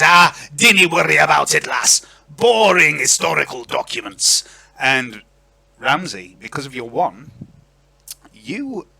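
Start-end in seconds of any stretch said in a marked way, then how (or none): 0.0-4.4 loud, dismissive Scottish lord; broad Scottish accent. Acted. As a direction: brush it off in a raised Scottish voice. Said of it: "I apologise in advance for this accent".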